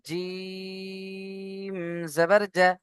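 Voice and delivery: slow recitation chant; drawn-out recitation. Shown as a demonstration. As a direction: stretch জবর out like a chant. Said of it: "এইভাবে ইয়া জবর ইয়া পর্যন্ত পড়বেন".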